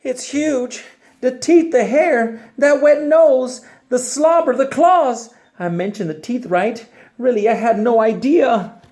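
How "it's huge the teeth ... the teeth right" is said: high, loud, frantic listing; panicked pigeon. Acted. Pigeon panics about the puppy's teeth and claws. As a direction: babble in rising panic.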